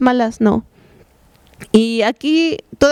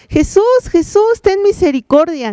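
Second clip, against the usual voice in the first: anxious pleading cry; 7 semitones higher than usual. Acted. Lepers clamouring to Jesus as he passes, anxious high-pitched cry. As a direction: imitate desperate men crying out for mercy. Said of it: "gritando, llorando".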